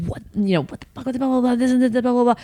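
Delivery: high-pitched, held-back imitation of yelling; mock yell. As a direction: rattle off a mock rant without real volume. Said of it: "I yelled, I was like".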